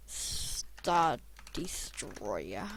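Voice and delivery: ordinary talk, nothing marked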